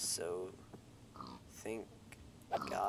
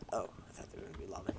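fast mutter; 6 dB quieter, much faster than usual. Real, not acted. Fast relieved aside.

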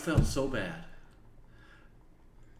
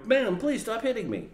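pleading; 12 semitones higher, 6 dB louder than usual. Retold or imitated. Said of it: "if you duck and go".

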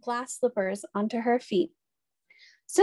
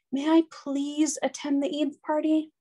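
put-on girlish pleading voice; 5 semitones higher than usual. A narrator's reading. Cinderella pleads with stepmother.

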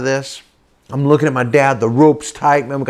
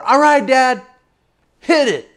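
high call out to the boat; acted-out high-pitched holler; 10 semitones higher than usual. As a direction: holler across the water. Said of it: "And I holler".

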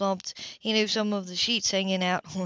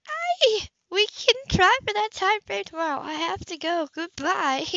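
much higher, startled and flustered woman; higher, flustered hang-up; rattled Anna; 11 semitones higher than usual. A narrator's reading. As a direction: stumble then hurry off the phone. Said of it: "Pleased that he rattled her".

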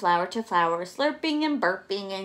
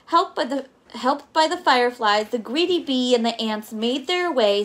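ordinary delivery, nothing marked

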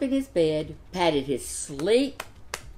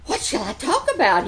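eager; 5 semitones higher, 4 dB louder than usual. A narrator's reading. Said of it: "he asked eagerly".

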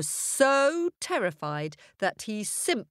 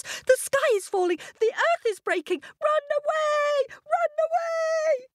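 panicked crowd shout. A narrator's reading. Animals shouting to the lion at once, frantic high cry. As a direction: shout it all at once in terror. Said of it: "began to shout at once".

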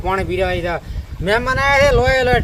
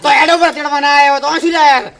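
raised, louder challenge; confrontational retort; 4 semitones higher, 6 dB louder than usual. Acted. In-law challenging the visitors' purpose, heated and loud. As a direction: throw the question back loudly and higher. Quarrel over taking daughter-in-law back.

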